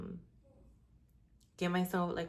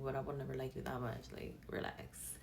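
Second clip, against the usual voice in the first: breathy hush; 5 semitones lower, 9 dB quieter than usual. Real, not acted.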